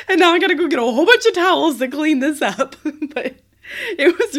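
high, loud laughing words; laughing while talking. Real, not acted. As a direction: say it through laughter. Laughing while telling mess story.

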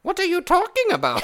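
high, loud elfin voice. Acted. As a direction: squeak it out like a forest elf. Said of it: "You sound like an elf".

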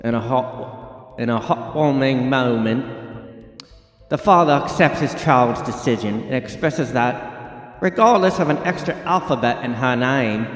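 hammed-up British news-reading accent; British newsreader. Host reads Starbucks ad plot, mock British newsreader accent. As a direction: play a mock British anchor telling a heartwarming story. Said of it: "I'm having trouble with the accent".

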